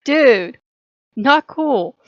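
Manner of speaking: loud, sharp rebuke of a friend; mock scolding. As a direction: tell him off, half-joking. Scolding someone over an insult.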